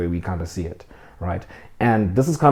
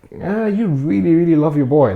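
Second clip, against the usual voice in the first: voicing God; 7 semitones higher, 4 dB louder than usual. God voiced, tender exaggerated quote.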